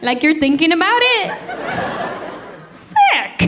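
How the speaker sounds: high, disgusted disbelief; disgusted wife. Retold or imitated. Wife refusing husband the toothbrush, high and disgusted. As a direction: recoil at the idea, voice climbing. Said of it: "I said".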